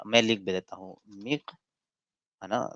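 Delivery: ordinary talk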